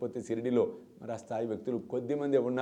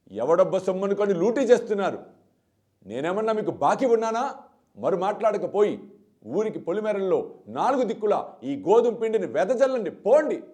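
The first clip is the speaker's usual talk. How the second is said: high, loud scolding shout that builds to పోండి; 6 semitones higher, 7 dB louder than usual. Retold or imitated. Baba shouting at the women leaving with flour, loud scolding. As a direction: roar at them to scatter the flour. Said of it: "అని గట్టిగా అరిచాడు బాబా".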